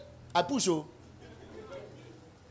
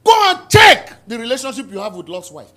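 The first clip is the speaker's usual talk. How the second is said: loud rebuke, full force; preaching shout; 3 semitones higher, 12 dB louder than usual. Real, not acted. Preacher shouting a rebuke at the congregation. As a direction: thunder it at the congregation.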